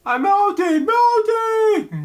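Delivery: shrieking way up high like the Oz witch as she melts; melting witch impression. Reader spoofs the Wizard of Oz witch, high melting shriek. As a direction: wail it like the wicked witch dissolving. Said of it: "Hold on, for the Wizard of Oz".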